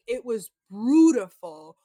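slow, drawn-out talking-head impression. Retelling his talking head, slow mock-dramatic imitation. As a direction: stretch each word out slowly, mock-dramatic. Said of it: "In his talking head, he's like".

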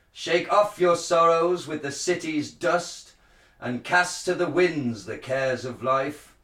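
Shakespearean actor. Shown as a demonstration. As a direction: proclaim it like a druid priest. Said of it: "like a Shakespearean, um, uh, actor".

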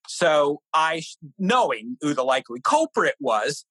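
usual speaking voice

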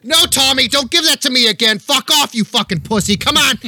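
high-pitched Boston shouting; Boston accent. Acted. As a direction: holler like an irate Bostonian, very high. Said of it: "You hear the Boston accent".

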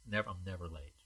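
normal talking voice

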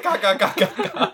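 loud high caws through laughter; two birds squawking, comically imitated; laughing bird-caw imitation; crow-like squawking. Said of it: "两只都在嘎嘎嘎嘎嘎".

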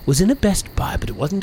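high, slow dramatic storyteller; horror host. Acted. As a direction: draw out the spooky tale. Ghost story narration.

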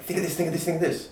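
high-pitched repeated nagging; anxious nagging. Acted. Anxiety pulling at the brain, mock nagging.

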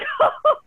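re-enacted high, breathless squeal; high excited gasp. Actress reliving a casting invitation, high stunned gasp. Acts out her stunned reaction.

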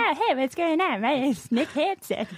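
high-pitched coworker impression; Nick Hansen. Shown as a demonstration. Host shows off coworker voice on request, high impression. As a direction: greet like an eager coworker, voice pushed way up. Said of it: "I can talk like my former coworker".

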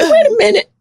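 high-pitched dawning disbelief; retold astonishment. Actress realising Chris called her funny, acted astonishment. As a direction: let the voice leap up as it sinks in.

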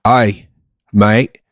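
broad Aussie accent; Australian accent. Shown as a demonstration. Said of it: "now my Australian game is strong".

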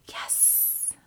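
soft whispered yes. Pretend. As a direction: whisper along. Whispered agreement.

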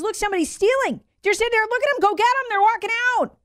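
high squeaky mock-indignant voice; shrill-pitched parody of a fussy politician; mocking Newsom. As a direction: lampoon the governor in a whiny high pitch. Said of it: "and he says".

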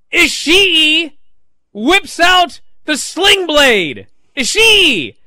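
loud, high, drawn-out exclamations; thrilled shouting, slow for emphasis; amazed excitement. Real, not acted. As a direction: announce the shock move in awe, hitting each phrase hard. Relives the shock move.

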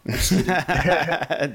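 a quick delighted laugh; bright laugh. Real, not acted. Host laughs at skating at midnight.

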